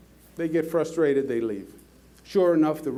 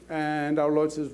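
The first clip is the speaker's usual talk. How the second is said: usual speaking voice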